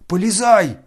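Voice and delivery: loud high roar. A narrator's reading. Ferdyshchenko roaring at Ganya to go in. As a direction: bellow the order. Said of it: "заревел Фердыщенко".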